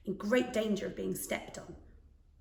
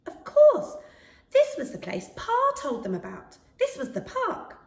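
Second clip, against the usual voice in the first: excited realisation; 12 semitones higher, 7 dB louder than usual. A narrator's reading. Max recognises Pa's park.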